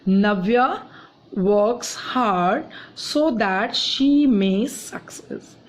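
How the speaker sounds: Navya set apart after a pause, then works hard so that she may success drawn out slowly; patient teacher dictating an example to the class; slow deliberate reading. Shown as a demonstration. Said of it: "teacher repeats the example slowly".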